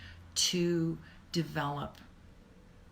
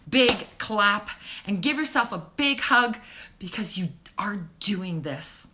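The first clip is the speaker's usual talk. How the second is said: ordinary talk, nothing marked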